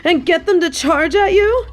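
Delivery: shrill panic as lions charge; frantic high-pitched plea; panicked high pleading. Acted.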